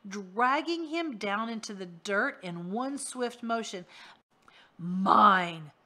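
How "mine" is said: loud, hollow monster claim; voice from the ground. Acted. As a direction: growl it up from under the earth. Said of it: "said a voice out of the ground".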